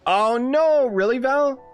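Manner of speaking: high, loud, drawn-out oh no; dismayed surprise. Real, not acted. Streamer learns from Val of a leak, dismayed. Streamer reacts to spoiler news.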